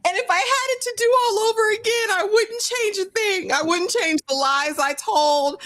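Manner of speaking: high, fast mock confession; mock confessional. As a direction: parody a dramatic reality-show confession. Mocking a contestant's confession.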